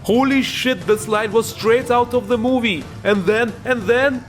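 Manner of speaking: blown away, high-pitched gushing; high, thrilled outburst; amazed excitement. Real, not acted. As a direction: react like a stunned fan, pitch shooting up. Hyped reaction to game scene.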